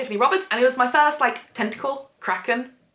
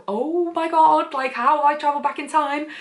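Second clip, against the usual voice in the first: higher, mock-panicked heroine; imitated freak-out; 6 semitones higher than usual. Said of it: "she's, like, freaking out".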